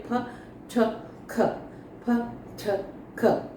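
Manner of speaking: "फ, छ, ख" with a strong puff of air on each; breathy aspirates. Shown as a demonstration. Teacher demonstrating aspirated letters with an audible puff of air. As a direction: push air out hard on "फ" "छ" "ख". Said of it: "हम उसको हवा निकालते हैं".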